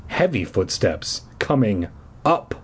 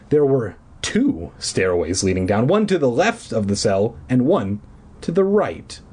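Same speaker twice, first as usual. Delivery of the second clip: ordinary delivery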